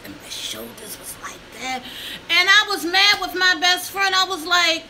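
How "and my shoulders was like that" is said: hushed unvoiced aside; whisper. Real, not acted. To viewers, whispering how her shoulders hunched. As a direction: drop to a whisper.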